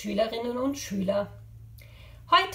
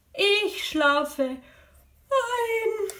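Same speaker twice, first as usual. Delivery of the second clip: very slow, high sleepy stretch; pretend falling asleep; 9 semitones higher, much slower than usual. Jaguar in the game falling asleep, drowsy drawn-out voice. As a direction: drift off on a drawn-out yawn.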